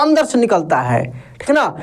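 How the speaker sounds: ordinary talk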